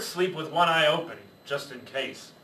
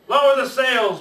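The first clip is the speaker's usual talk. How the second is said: loud, higher shout; storm command shout; 8 semitones higher, 8 dB louder than usual. Acted. Pirate captain orders the crew in a storm, loud shout. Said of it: "Braidbeard shouted".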